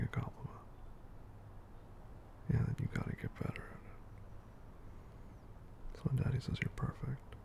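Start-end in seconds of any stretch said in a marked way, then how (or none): 2.5-7.4 whispering. Acted. Whispered coaxing to partner.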